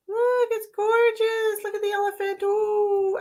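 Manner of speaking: high delighted cooing. Real, not acted. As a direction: coo over something lovely. Admiring the card.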